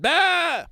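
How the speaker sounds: loud high mock goat bleat. The goat answers Fish America with a loud high bleat. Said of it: "The goat said".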